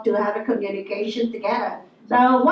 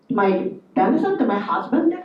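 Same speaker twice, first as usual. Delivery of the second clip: usual speaking voice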